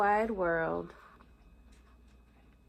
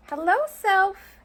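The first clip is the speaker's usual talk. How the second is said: high, louder squeaky mouse greeting; little mouse voice; 8 semitones higher, 6 dB louder than usual. Acted. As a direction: greet your reflection like a tiny mouse. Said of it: "he says".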